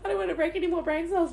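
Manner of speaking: high, rushed words through laughter; breaking into laughter, talking fast; giddy laughing. Real, not acted. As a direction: rattle it off giddily after screaming. Coming down from the scream.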